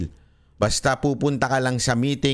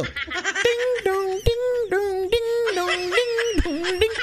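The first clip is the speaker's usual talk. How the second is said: very high sing-song ding dong like a quiz show chime; game show buzzer; slower than usual. Pretend. Vlogger hurrying viewers to answer, game show chime. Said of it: "ganyan pag mga game show".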